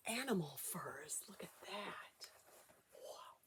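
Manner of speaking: breathless hushed whisper; soft awed whisper. Acted. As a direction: whisper in wonder at the fur. Showing soft animal furs.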